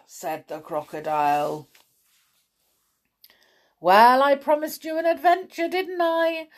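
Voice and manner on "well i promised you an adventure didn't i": quick, high, grinning tease. A narrator's reading. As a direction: say it with a big grin. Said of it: "grinned Mister Daydream".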